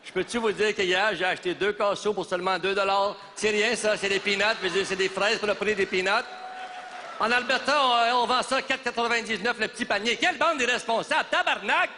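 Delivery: high-pitched tirade climbing to a curse; exasperated rant. Acted. Politician scolding the students over the price of fraises, exasperated rant.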